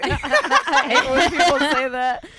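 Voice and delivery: laughing through it, slowed down. Real, not acted. Friend mocking a cliché, laughing. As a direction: giggle while saying it, drawn out.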